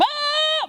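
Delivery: high-pitched imitated kid scream; loud, high "ah!"; mock scream. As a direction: shriek like a fleeing little brother. Brother runs out screaming.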